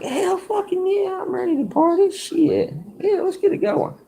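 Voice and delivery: put-on squeaky, sky-high whoop of drunk excitement; hyped-up party yell. Invited to the bonfire, he squeals back in a sky-high, thrilled voice. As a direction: crank your voice way up like an overexcited drunk kid.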